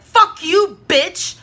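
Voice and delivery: loud, high furious yell; shouting a curse at her; angry shout. Retold or imitated. Telling Tatiana off.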